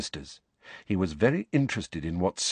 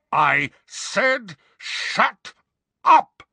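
furious high shout, word by word; roaring; 11 semitones higher, 5 dB louder, much slower than usual. A narrator's reading. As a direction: roar the order, exploding at the end. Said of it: "roared the man".

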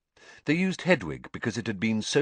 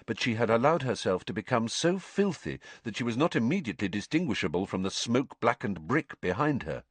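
plain reading voice

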